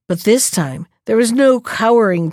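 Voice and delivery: usual speaking voice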